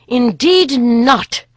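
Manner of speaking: slow, loud, held-back rage; leashed fury. Acted. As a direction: bite each word, barely contained. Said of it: "she answered with leashed fury".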